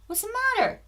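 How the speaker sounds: high sing-song puppy voice; pet talk to the dog. Real, not acted.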